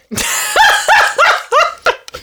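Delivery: high cackling witch laugh; witch cackle. Real, not acted. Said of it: "Sorry for my witch cackle".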